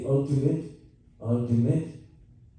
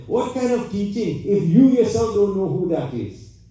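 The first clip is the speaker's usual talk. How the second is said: yelling angrily; 8 semitones higher than usual. Retold or imitated. Brahman yelling at the guru who had no answer, angrily. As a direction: hurl the questions at him. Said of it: "He yelled there".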